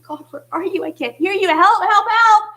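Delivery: desperate cry. Retold or imitated. Someone overwhelmed by noise crying out to God, building to a loud plea. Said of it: "we start to cry and call out".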